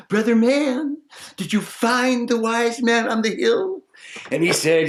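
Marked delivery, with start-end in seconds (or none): 0.1-3.8 higher eager maiden's call. Acted. Maiden calling after the simple man, higher and eager. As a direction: hail him eagerly.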